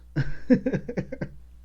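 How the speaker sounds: quick unvoiced laugh; a short airy chuckle; breathy laugh. Real, not acted. Laughs at own point.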